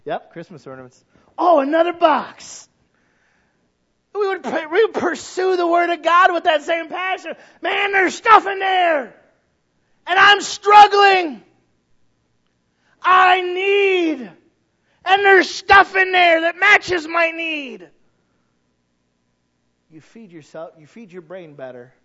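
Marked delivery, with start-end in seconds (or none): 1.4-2.7 loud, high gasp at a new box; excited discovery. Acted. To the congregation, acting a loud delighted find. 4.1-17.9 shouted, high, slow preaching; preaching at full force. Acted. Pastor to his church, full-force shouted preaching.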